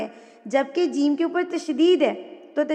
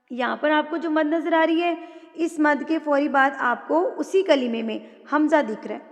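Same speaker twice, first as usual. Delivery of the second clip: normal talking voice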